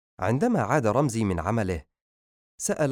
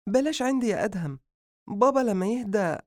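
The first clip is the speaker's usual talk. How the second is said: pleading sister; 12 semitones higher than usual. Acted. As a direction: plead with your brother to wait.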